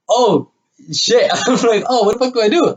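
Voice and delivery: high, laughing retold panic; mock panic. Retelling panic as girl cries.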